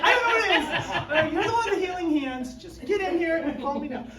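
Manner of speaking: hammed-up needy customer; mock whiny client. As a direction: beg the therapist to hurry up.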